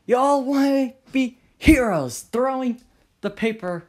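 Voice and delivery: loud, high-pitched teacher's proclamation; high, loud hype; rousing announcement. Acted. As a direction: call it out big to the whole class. Acted teacher rousing the class.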